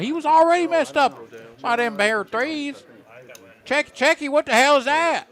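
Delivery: high, loud hammed-up southern twang; southern accent. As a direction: whoop it up in a big Southern accent. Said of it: "hear [name] talk like that".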